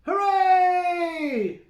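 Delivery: high, loud hooray; cheering goat. Acted. Mike cheers his dad, high loud cheer. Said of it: "shouted Middle-sized Mike".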